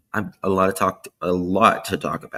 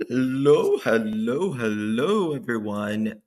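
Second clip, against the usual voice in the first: sing-song hello; 3 semitones higher, slower than usual. Real, not acted. Streamer sing-songing hellos to arriving viewers, bright and lilting. Livestream host greeting chat.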